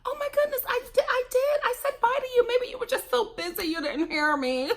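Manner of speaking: high-pitched, put-on apologetic voice; mock innocent excuse. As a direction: play innocent in a high fake voice. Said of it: "And this is me".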